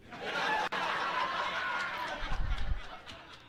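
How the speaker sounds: high unvoiced wheezing laugh; a breathless squeal of a laugh; wheezy laugh. Real, not acted. Streamer laughing at the grim backstory, high wheezing laugh.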